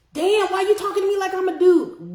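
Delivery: high-pitched, whiny mock complaint; offended caller. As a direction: imitate a hurt caller protesting.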